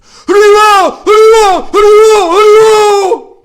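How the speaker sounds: very high, ringing chant-like shout; full shout. Retold or imitated. Devotee leaping up in ecstasy, shouting the holy name. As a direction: holler it at the top of the voice.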